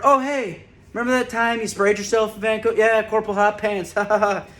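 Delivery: lightly voiced jeering colleague; teasing coworker. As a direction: rib him like a coworker enjoying the joke.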